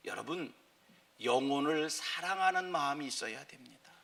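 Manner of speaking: ordinary delivery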